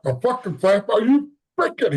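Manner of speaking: high, incredulous outburst; shrill-pitched disbelief, losing it; dad losing it. Retold or imitated. Father yelling at son who wants to be a cop, high furious outburst. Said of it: "he just losing it".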